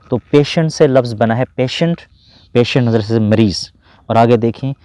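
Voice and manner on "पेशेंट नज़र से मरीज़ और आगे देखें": usual speaking voice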